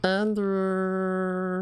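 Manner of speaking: put-on whiny baby calling a name. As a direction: drag the name out like a toddler. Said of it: "talking like a fucking baby".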